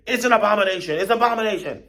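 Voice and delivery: high, loud, repeated alarm; panicked cry. Acted. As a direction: cry out as a stampeding buffalo in panic. Panicked stampeding buffalo answer.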